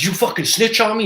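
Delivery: high-pitched mock threat from the bully; getting serious. Schoolyard punk accusing the boy, mock angry. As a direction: raise it high, like a punk picking a fight. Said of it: "He starts getting serious".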